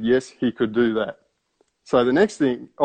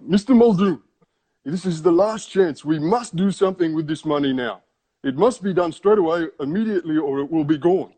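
irate; 6 semitones higher than usual. Retold or imitated. Scammer pressing the narrator by phone, mock irate. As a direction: retell the scammer's urgent demand with mock anger. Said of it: "he was quite upset and irate with me".

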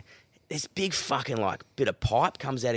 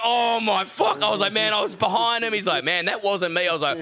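high, laughing horror; mortified through laughter; 10 semitones higher, slower than usual. Retold or imitated. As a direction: squeal it out, mortified and giggling. Realizes he chased a stranger.

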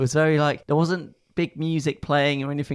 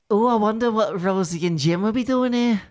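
put-on Liverpool accent; 8 semitones higher than usual. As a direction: say it like a cheerful Liverpudlian. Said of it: "No, that's not how John speaks".

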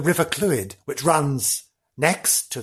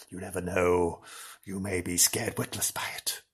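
low, hushed spooky warning; soft, low, near-whispered menace; ominous hush; 7 semitones lower, 9 dB quieter than usual. Acted. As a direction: drop right down to tease a scare. Ominous hushed close of ghost story.

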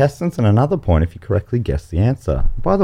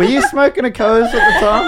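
laughing shout; 12 semitones higher than usual. Real, not acted. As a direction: tease through laughter. Teasing laugh.

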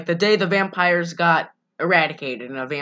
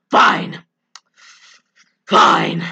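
sulky mock fine; 3 semitones lower, 6 dB louder than usual. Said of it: "he's like".

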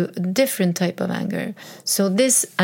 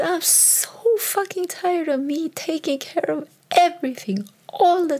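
high-pitched exasperated outburst; blurting how fed up she is, pitched way up; retold snap; 10 semitones higher than usual. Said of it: "I snapped".

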